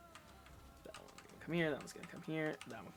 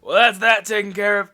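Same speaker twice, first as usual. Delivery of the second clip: Esser satisfied; 2 semitones higher, 10 dB louder than usual. Acted. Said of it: "Esser kinda looks over and goes".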